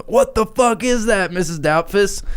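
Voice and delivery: high-pitched, drawn-out mock shriek; mock scream. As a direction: act out a family's horrified scream without full volume. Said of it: "everybody starts fucking, like, screaming".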